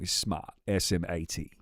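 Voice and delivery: "smart" said as "smaht", no R; non-rhotic British. Shown as a demonstration. Streamer explaining British speech, "smart" without its R. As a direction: drop the R in "smart", then spell it out. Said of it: "You don't pronounce the R".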